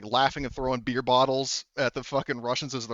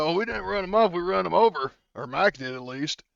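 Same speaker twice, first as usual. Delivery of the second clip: lightly voiced hillbilly, pitched up at first; country brother; 6 semitones higher, 3 dB louder than usual. Brother boasting about running over the Russians, lightly acted country voice. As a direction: correct them with a touch of backwoods pride. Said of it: "Oh, it's like".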